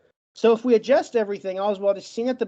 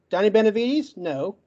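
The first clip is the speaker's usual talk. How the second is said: ordinary delivery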